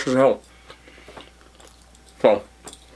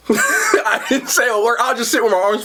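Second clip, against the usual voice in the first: laughing; 7 semitones higher than usual. Real, not acted. Laughing while retelling.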